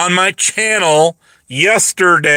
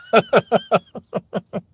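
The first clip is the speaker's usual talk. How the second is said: squealing high cackle; high falsetto laugh; 3 dB quieter than usual. Real, not acted. Laughing at his own shopping-channel joke. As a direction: break into a shrill giggle. Laugh after the pitch parody.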